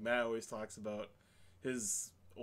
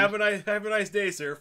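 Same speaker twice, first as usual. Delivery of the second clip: loud, high fake politeness; mock courtesy; 9 semitones higher, 13 dB louder than usual. Worker seeing off a customer, loud mock politeness. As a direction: overdo the customer-service pleasantry loudly.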